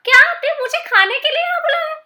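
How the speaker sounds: high, fast shocked gasp of a question. Acted. Monkey realising he was invited to be eaten, shocked high cry.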